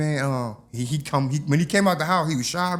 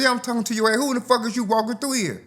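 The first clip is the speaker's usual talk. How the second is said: defiant; 8 semitones higher, faster than usual. Retold or imitated. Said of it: "I said".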